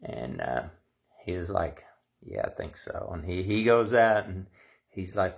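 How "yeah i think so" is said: whisper. Retold or imitated. Tim's sheepish whispered reply.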